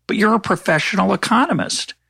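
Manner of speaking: mock journalist. Journalist quoted, high mock voice.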